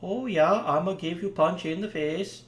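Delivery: mock Swedish accent, lightly done; bad Swedish accent. Game streamer jokes about Swedish player Bergstrom, bad Swedish accent. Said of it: "I'd make a Swedish accent".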